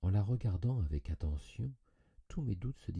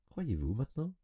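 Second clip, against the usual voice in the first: lightly amused, higher-pitched challenge; smiling question; 8 semitones higher than usual. A narrator's reading. Doctor smiling at the convinced visitor, lightly amused. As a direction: ask it with a knowing smile. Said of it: "il m'écouta en souriant".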